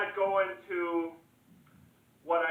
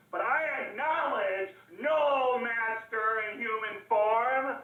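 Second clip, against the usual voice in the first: higher, emphatic oratory; defiant proclamation; 4 semitones higher than usual. Acted. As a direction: declare it defiantly to the crowd. Orator defies all masters.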